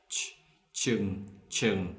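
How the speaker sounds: "chung" with a crisp northern ch; northern ch sound. Shown as a demonstration. Teacher showing northerners' ch in "chung". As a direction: say "ch, chung" with a clean ch like English church. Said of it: "they will pronounce like ch".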